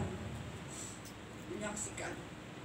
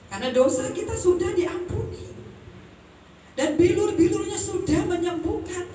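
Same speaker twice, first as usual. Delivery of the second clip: fervent preaching; 13 semitones higher than usual. Acted. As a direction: lift the church with every word. Preaching at full force.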